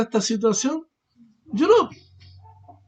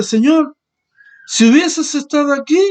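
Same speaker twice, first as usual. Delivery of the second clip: Mary pleading; 7 semitones higher, 7 dB louder than usual. Acted. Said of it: "diciéndole".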